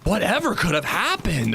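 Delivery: mock-innocent, put-on bafflement; playing dumb. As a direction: imitate someone feigning cluelessness. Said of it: "they just played dumb".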